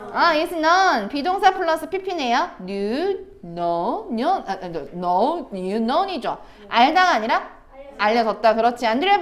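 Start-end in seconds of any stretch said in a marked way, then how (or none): none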